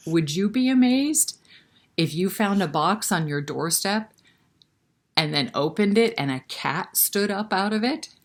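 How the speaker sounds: ordinary delivery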